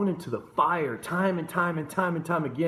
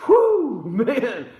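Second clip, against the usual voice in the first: excited exclamation; 9 semitones higher, 9 dB louder than usual. Real, not acted. Preacher's burst after prayer.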